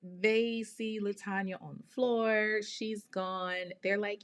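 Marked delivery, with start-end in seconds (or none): none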